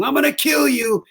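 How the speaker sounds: high, strained mock yell of a scared big guy; imitating a yell. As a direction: voice Phil shrieking threats from the back seat. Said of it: "just yelling".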